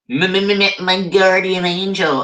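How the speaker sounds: high, stammered awe; stuttering. Acted. Narrator meeting the guardian angel, stuttering. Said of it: "I stuttered".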